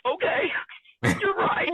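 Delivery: high, giggly okay you're right; laughing concession. Retold or imitated. Wife conceding to husband, high laughing agreement. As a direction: give in with a laugh. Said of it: "I was like".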